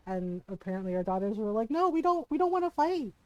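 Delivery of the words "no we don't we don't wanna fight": put-on high-pitched, hurried-to-refuse kid quote; mock protest. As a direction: imitate the daughters' protest, pitched much higher. Daughters retold refusing to fight.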